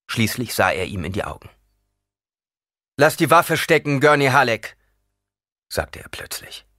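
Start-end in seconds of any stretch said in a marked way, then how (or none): 3.0-4.8 raised, firm command to lay down the weapon; a stranger ordering Gurney to stand down; commanding Fremen. A narrator's reading. Paul commands Gurney to sheathe his weapon, firm.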